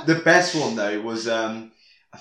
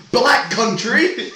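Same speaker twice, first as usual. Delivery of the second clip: outburst; 9 semitones higher, 7 dB louder, much slower than usual. Retold or imitated. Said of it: "he's like".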